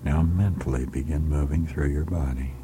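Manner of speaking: normal talking voice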